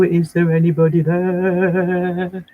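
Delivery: teacher lightly voicing toy Rexy calling the pupils; Rexy's voice. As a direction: speak it slowly as the pet dinosaur Rexy. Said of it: "Rexy wants to know".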